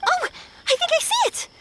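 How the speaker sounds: high, bright surprised exclamation; delighted to spot it at last; sudden discovery. Acted. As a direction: gasp out the find. Spots the object.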